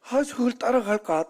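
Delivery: high, tempted inner voice, half-joking; tempted thought. Retold or imitated. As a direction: voice the sudden urge to give chase. Tempted to chase the car. (따라가고 싶은 유혹의 속마음)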